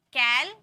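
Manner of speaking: कैल with a wide "ae"; wrong AE vowel. Shown as a demonstration. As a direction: say कैल as "kael", like the vowel in cat. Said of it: "नहीं". (कैल में चौड़ा ऐ)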